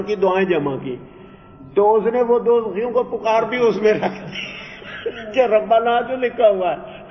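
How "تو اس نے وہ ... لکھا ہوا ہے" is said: laughing while telling. Real, not acted. Mocking a foolish compiler.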